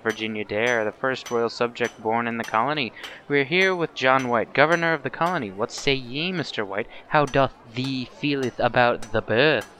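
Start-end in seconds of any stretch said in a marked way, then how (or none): none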